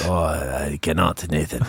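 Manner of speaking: slow, low put-on Russian accent; hammed-up Russian accent, slowed right down; mock Russian accent. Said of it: "Can you do a Russian accent?".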